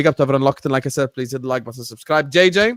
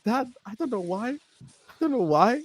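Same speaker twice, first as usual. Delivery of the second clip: high, softer pleading voice; mock-helpless answer in a raised pitch; retold helpless reply; 8 semitones higher, 5 dB quieter than usual. Replying to ranting dad, retold.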